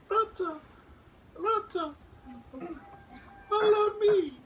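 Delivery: very high, small calling voice; a tiny creature calling up from the floor; high tiny insect calling Rata to follow. Acted.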